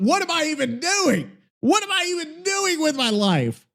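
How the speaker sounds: high-pitched, helpless lament; exasperated despair. Real, not acted. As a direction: wail it high, at wits' end. Despairing at the failing podcast.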